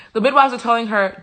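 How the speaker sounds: ordinary delivery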